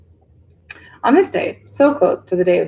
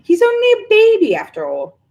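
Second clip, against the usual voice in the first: high, loud, then dropping; protective witch; 14 semitones higher, 5 dB louder than usual. A narrator's reading. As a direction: plead for the baby dragon. Witch defends the dragon.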